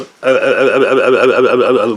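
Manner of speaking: repeated guttural uh sounds from the throat; choppy throat-clicking uh noises; throaty grunts. Retold or imitated. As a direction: mimic a lady grunting uh in her throat. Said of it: "with her throat".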